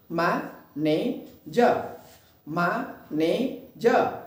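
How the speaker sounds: "मैनेजर" broken into "मा, ने, जा", slowly; three separate beats with pauses: मा, ने, जा; split into syllables. Shown as a demonstration. Teacher showing the class three jaw drops, syllable by syllable. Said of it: "इस तरह से इसको बोलने में".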